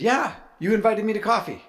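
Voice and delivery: teasing. Retold or imitated. Teasing the friend.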